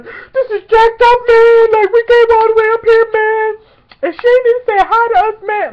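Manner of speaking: very high, loud wail; mock tearful outrage; upset crying protest. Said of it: "We're all mad and stuff".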